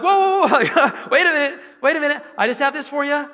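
high, loud, flustered backing off; alarmed, laughing nervously. Retold or imitated. To the cop reaching for his gun, high loud nervous pleading. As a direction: panicky calming of a man with a gun.

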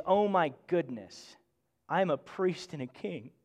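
hushed gratitude, almost unbelieving; humbled awe. Shown as a demonstration. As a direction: say it softly, overwhelmed at the gift. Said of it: "what we're supposed to connect to is".

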